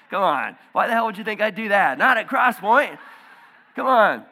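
joking with laughing congregation, loud mock protest; mock indignation. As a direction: ham up being offended.

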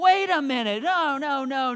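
loud startled self-correction, snapping out of it; sudden loud wait, reining in a scary thought; alarmed outburst. Retold or imitated. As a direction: catch yourself mid-panic, loud. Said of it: "And I'm like".

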